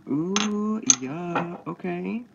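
high startled ooh at the heat; surprised ooh. Real, not acted. Cook touching a hot egg cup, surprised ooh.

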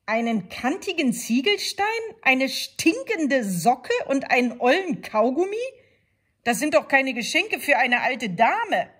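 shocked wolf lecturing; outraged wolf. A narrator's reading. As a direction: scold the careless granddaughter. Wolf scolds the girl.

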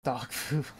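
breathy playful whisper with a giggle. Pretend. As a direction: whisper it like a secret joke. Joking whisper during stream chat.

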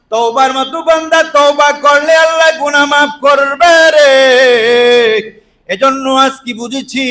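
high, loud, chanting sermon cry; full-force preaching. Real, not acted. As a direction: belt it out to the congregation, drawing out the last word.

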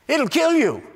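normal talking voice

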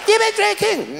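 very high falsetto, suspicious; wife's voice. Retold or imitated. Wife asking her husband again, high suspicious falsetto.

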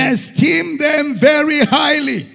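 loud, slow, ringing pulpit shout; full-force preaching. Acted. Pastor thundering at his church, slow and loud. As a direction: drive it home to the congregation.

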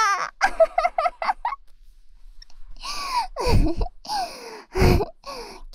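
high, loud delighted giggling; long high giggle. Acted. Long giggle after teasing.

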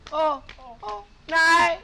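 indignant call; shouting after. Acted. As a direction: shout after someone running off. Shouting after fleeing girl.

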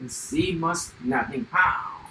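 ordinary talk, nothing marked

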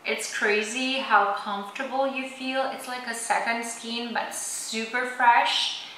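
face stiff under wet mask; through a sheet face mask. Real, not acted. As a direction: lips framed by mask opening. Seen 0: Gold sheet mask covers whole face throughout; mouth opening left free.

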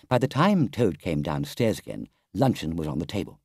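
ordinary delivery

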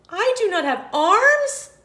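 high, loud shocked question; shocked snake. Acted. Snake discovers he lacks arms, high and loud. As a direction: squeal it in mock alarm. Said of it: "the snake said".

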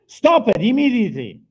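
high, loud and urgent; shouted quote. Retold or imitated. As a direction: cry it out over the pain. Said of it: "And she says".

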